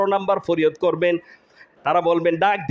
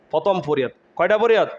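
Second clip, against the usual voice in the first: ordinary talk, nothing marked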